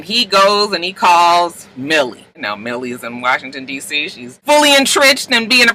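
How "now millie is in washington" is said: plain reading voice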